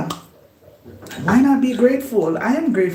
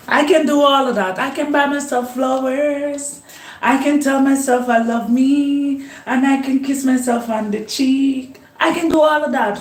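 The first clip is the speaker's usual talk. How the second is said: upbeat self-love; 6 semitones higher than usual. Acted. Self-love chant, raised voice.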